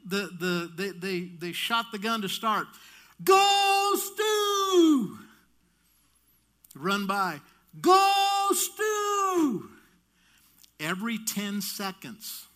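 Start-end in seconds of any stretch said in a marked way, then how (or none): 3.2-5.2 very high, loud, sustained holler; mom's cheering yell. Retold or imitated. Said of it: "she would yell". 7.8-9.7 very high, loud, sustained holler; mom's cheering yell. Retold or imitated. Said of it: "she would yell".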